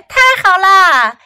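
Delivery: high, loud cheer; excited kids' yell. A narrator's reading. Ling kids celebrate as mother leaves, excited yell. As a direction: call it out like delighted children. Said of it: "林家的孩子们大喊起来".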